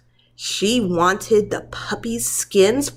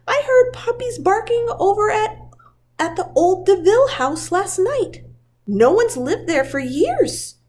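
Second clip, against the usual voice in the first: put-on an old shepherd dog's voice, pitched well higher; the Colonel; 11 semitones higher than usual. The Colonel telling Tibbs about the house, in his character voice. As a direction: voice the Colonel reporting the barking.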